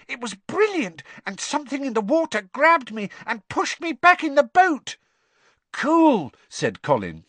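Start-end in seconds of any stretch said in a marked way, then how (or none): 0.1-5.0 put-on high-pitched, thrilled little boy; shrill. A narrator's reading. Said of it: "he said shrilly". 5.7-6.3 put-on high, loud boyish awe; thrilled younger-schoolboy exclamation; excited. A narrator's reading. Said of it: "just as excitedly".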